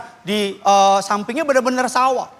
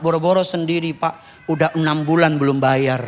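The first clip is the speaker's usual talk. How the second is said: a lower, drawling churchgoer voice; mock poor congregant; 11 semitones lower than usual. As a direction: play the humble old man, low. Jemaat menjawab soal rumah. (suara bapak jemaat yang rendah)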